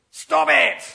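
loud, high telling-off; stern shout. Retold or imitated. Jesus rebuking the storm like a parent scolding kids, loud stern shout. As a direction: shout it like scolding misbehaving children. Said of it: "like you would shout at your children".

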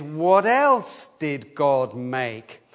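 each word spaced out, slow and deliberate; slow recitation. Shown as a demonstration. Leader reading the catechism question to children, slow and deliberate. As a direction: read it out slowly for the children to follow. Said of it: "the next question is".

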